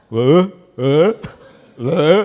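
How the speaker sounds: short, loud puzzled questions; acted bewildered replies; baffled son. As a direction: imitate a son with no idea what's going on. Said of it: "얘는 아무것도 몰라요".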